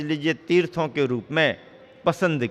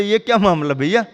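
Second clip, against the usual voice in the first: quick, higher, louder baffled protest; mild mock dismay; 5 semitones higher, 5 dB louder, faster than usual. Author seeing the misprinted cover, mild mock dismay. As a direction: retell an author's exasperated question. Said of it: "माथा ठोक लिया".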